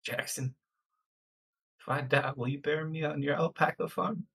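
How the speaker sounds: soft, quiet in-character plea. Acted. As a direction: ask it quietly, half serious.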